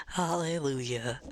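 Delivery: hammed-up Southern sweetness; mock Southern belle. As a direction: play a syrupy Southern lady, stretching every word.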